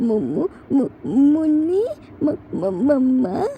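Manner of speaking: put-on stammering toddler voice between sobs; sobbing child. Frightened toddler calling grandma on phone, sobbing and stammering. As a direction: stutter out the words like a crying little boy. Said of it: "while sobbing hard".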